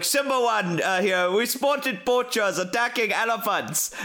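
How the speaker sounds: put-on urgent radio call, African accent. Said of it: "they'll be like".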